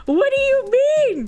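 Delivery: very high, wailing question; squealed disbelief. Real, not acted. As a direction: squeal it out in exaggerated bewilderment. Repeats it, more frantic.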